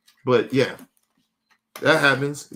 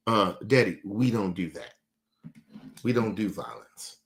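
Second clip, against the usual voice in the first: put-on lower, softer gentle child's reproach; father imitating his daughter, softer and lower; 5 semitones lower, 5 dB quieter than usual. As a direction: quietly mimic the little girl.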